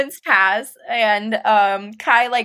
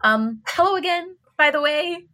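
high-pitched, hesitant hello; a tentative, awkward greeting lifted in pitch; nervous greeting; 6 semitones higher than usual. A narrator's reading. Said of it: "brief, nervous smile".

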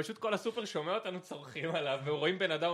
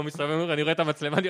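usual speaking voice

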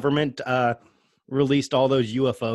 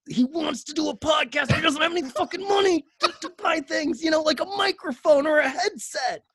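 excited rant; 11 semitones higher than usual. Real, not acted. Ranting about friend's gear.

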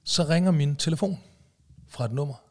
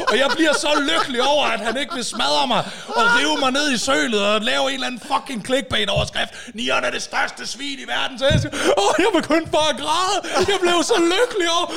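high, loud words through laughter; laughing with joy; 10 semitones higher, 5 dB louder than usual. Real, not acted. Telling his joy, laughing. (højt, leende af lettelse)

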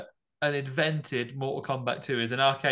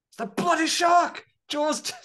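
hammed-up panicked yell, much higher and louder; mock panic; 5 dB louder than usual. Brody imitated blaming the shark, in a hammed-up panicked yell. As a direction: shriek it like he's seen the shark.

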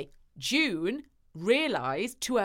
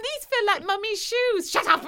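put-on high, posh little child; 10 semitones higher than usual. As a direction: sound like a small girl.

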